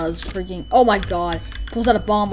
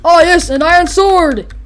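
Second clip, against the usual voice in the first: slow, loud, higher exclamation; mock delight; 8 semitones higher, 9 dB louder, slower than usual. Player voicing a character finding an iron sword, thrilled. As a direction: relish the find with glee.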